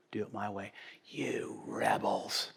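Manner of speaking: slow, hushed, unvoiced contempt; whispered scorn. Preacher as Moses whispering scorn at the people. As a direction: hiss it low at the rebels.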